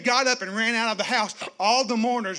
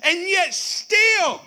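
preaching at full force; 5 semitones higher, 4 dB louder than usual. Real, not acted. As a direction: lift the room with fervor.

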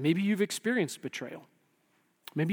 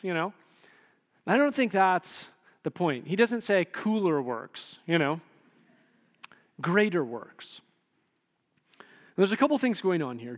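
ordinary talk, nothing marked

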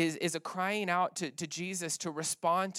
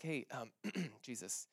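imitating an awkward, softly spoken approach; low, quiet, halting mock interjection; hesitant interruption; 3 semitones lower, 10 dB quieter than usual. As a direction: clear the throat and tentatively get his attention. Disciples awkwardly interrupt Jesus.

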